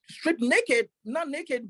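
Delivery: ordinary talk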